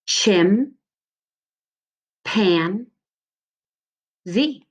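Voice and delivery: Chim, pan, Z said as separate beats with pauses; syllables apart. Shown as a demonstration. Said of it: "I'm gonna crash it together".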